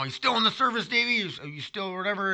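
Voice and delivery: lightly voiced grandpa asking the same thing again; imitating forgetful grandpa. Grandpa quizzing his grandson about the service, lightly imitated. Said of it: "Grandpa would always, you know".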